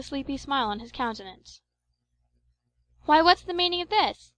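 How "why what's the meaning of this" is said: sudden high, loud question, startled awake. A narrator's reading. Narrator just woken by a yell, asking his friends, startled and loud. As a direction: demand an answer, alarmed and raised. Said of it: "I inquired".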